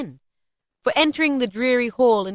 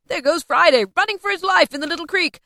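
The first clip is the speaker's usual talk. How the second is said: high, loud, laughing excitement; delighted laugh into an excited cry; thrilled; 8 semitones higher, 7 dB louder than usual. A narrator's reading. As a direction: cheer on someone fleeing for his life. Scrooge thrilled at his story heroes.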